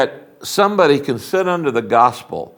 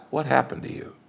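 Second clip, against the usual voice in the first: soft whispered question; gentle question; 10 dB quieter than usual. Shown as a demonstration. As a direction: drop to a hushed, caring whisper. Models the gentle question to ask.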